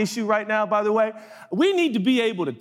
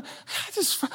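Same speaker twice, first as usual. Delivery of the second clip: whispered exasperation; 13 semitones higher, 8 dB quieter than usual. Retold or imitated. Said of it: "this is what I do with God".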